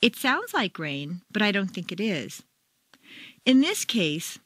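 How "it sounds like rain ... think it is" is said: "sounds" stressed and rising, then a doubtful fall; doubtful opinion. Shown as a demonstration. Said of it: "Two, opinion".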